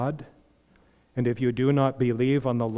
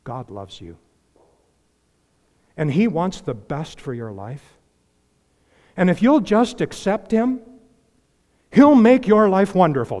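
a hint of a syrupy salesman preacher; mock prosperity preacher; 3 semitones higher, 5 dB louder than usual. Preacher mocking the false gospel he warns about, syrupy. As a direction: imitate the false gospel pitch. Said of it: "And it sounds like this".

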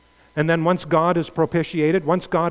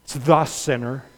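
"the" leaned on, not "a"; stress on the. Shown as a demonstration. Preacher correcting "a sinner" to "the sinner". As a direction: hit the word "the" in "It's the sinner". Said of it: "an incorrect translation".

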